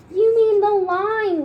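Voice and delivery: high, loud yell. Acted. Kids yelling at their teacher, high and loud. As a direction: yell it out together. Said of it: "we all yell".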